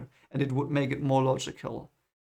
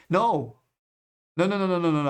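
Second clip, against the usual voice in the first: sudden correction; 4 semitones higher, 4 dB louder, faster than usual. Real, not acted. Correcting his own mistake.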